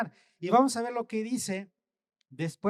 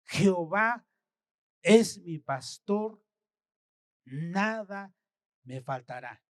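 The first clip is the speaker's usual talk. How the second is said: each word weighed slowly with pauses; slow, thoughtful; slower than usual. Shown as a demonstration. Preacher showing how to meditate on the verse, slowly. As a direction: savour every word, slowly. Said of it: "Entonces lo analizo".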